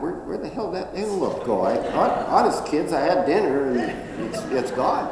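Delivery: hammed-up puzzled cheetah, half laughing; confused cheetah. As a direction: play the cheetah looking for its lost dinner. Cheetah voiced, confused and loud.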